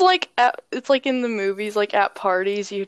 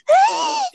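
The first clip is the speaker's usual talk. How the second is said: squealing laugh; 4 dB louder than usual. Real, not acted.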